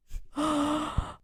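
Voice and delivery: sharp, audible intake of breath; gasp. Real, not acted. Reader reacting to a celebrity paternity meme, sharp gasp. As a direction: gasp in scandalised surprise. Mock shock after reading meme aloud.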